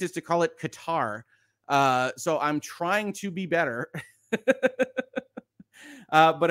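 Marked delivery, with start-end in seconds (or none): 0.6-1.2 "Qatar" said as kuh-TAR; "Qatar" with a long final TAR; American-style Qatar. Shown as a demonstration. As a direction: stress the second syllable of "Qatar". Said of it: "as an American, my instinct".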